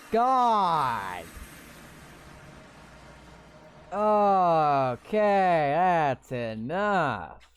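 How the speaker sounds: loud startled outburst, then slowing right down; jolted loud "okay, that's enough"; startled yelling. Real, not acted. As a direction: shout it as if blasted by a sudden noise. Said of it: "that was loud".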